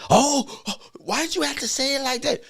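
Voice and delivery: mock offended whine.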